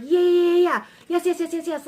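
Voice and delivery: high, loud burst of giddy yeses; anxious excitement. Acted. Card voiced as jumpy positive energy, anxiously excited. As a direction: cheer in an over-eager rush. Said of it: "an anxious positive energy".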